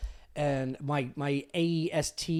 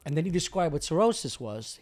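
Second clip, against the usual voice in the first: ordinary talk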